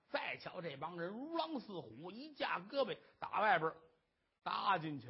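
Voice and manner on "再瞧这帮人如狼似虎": plain reading voice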